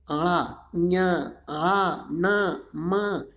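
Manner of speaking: आड़ा, ञा, आ, ना, मा sounded singly through the nose; nasal articulation. Shown as a demonstration. Teacher shows learners sounds spoken from the nose, nasal ञा, ना, मा. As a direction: send each of ञा, ना, मा out through the nose. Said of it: "देखो इसे नाक से बोल रहे हैं".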